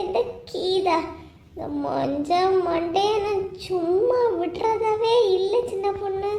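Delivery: keen over the beating; wailing lament. Acted. Beaten woman wailing to her friend about her husband, high and sing-song.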